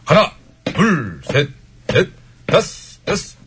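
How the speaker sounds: marching cadence. Shown as a demonstration. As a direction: call out the step count. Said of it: "이렇게 하면 되는 거죠".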